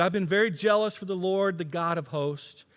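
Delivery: loud, high, aggrieved prophet; complaining Elijah. Acted. Preacher voicing Elijah complaining to God, loud and high. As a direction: pour out your complaint to God loudly. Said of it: "He said".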